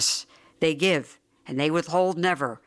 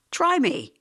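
higher-pitched, eager challenge; bluffing confidence; 11 semitones higher than usual. A narrator's reading. Beggar bluffing about wanting work, confidently eager. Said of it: "warming to the bluff".

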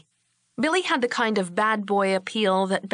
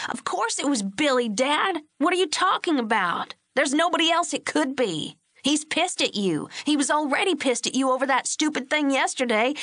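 higher, agitated ranting at his father; heated protest; 6 semitones higher than usual. A narrator's reading. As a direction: argue hotly, he is sure. Bobby rants at his father.

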